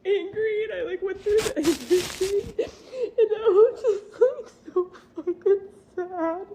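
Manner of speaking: tearful, breaking voice with laughs through the sobs; crying and half-laughing as it spills out; crying while talking. Real, not acted. Mother tells viewers about husband's betrayal, crying.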